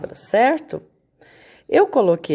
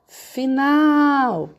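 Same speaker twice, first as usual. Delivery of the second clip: "final" called higher, NAL held long; chamando um amiguinho; 6 semitones higher than usual. Shown as a demonstration. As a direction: call "final" across the street, stretching nal. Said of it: "Chame a palavra final como se". (chamando "final" de longe, alongando o nal)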